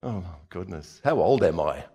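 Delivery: usual speaking voice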